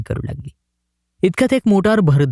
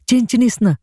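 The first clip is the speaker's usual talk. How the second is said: put-on higher, naive boy's voice with a falling end; village boy; 8 semitones higher than usual. A narrator's reading. The boy tells the collector his village, naive and higher. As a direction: say it plainly like a country boy.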